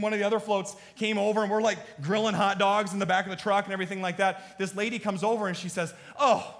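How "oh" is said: gushing oh. Retold or imitated. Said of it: "she's just beside herself".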